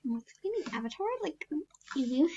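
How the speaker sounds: normal talking voice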